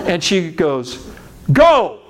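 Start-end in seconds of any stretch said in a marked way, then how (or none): 1.5-2.0 sharp loud high shout; yelled command. Retold or imitated. Pastor's wife yelling at him to go, loud shout. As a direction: bark the word at him. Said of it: "And she yelled it at me".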